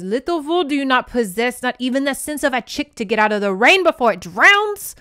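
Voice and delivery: loud, high, scornful rant rising to a yell; scolding insult. Retold or imitated. Le Boisseau scolding the foolish heroine, loud. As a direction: tear into her like a fool. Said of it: "Le Boisseau says".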